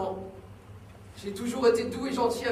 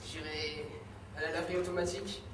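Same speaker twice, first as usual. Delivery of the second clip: much softer, lower murmur; 5 semitones lower, 10 dB quieter than usual. Acted. Son murmurs softly about the laundromat. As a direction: drift off into a quiet daydream.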